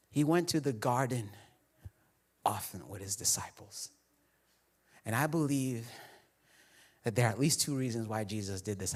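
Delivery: quiet pastoral reflection; soft reflective preaching. Acted. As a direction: lean in and confide it gently.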